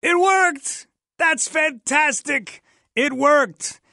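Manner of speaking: host thrilled the live alert test worked; real excited cheer. Real, not acted. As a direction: cheer like the test finally came through. Emergency alert test finally succeeds.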